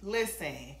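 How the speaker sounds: ordinary delivery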